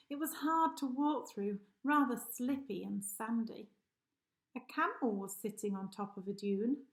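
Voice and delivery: plain reading voice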